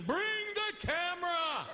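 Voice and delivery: husband yells at bathroom sight, slow and high; mock excited scream. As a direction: yell like you found a monster. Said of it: "You're screaming".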